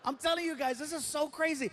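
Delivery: ordinary talk, nothing marked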